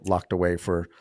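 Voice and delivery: normal talking voice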